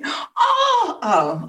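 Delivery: high squeal of surprise; surprised cry. Real, not acted. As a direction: yelp in mock shock. Reacts to being called pretty.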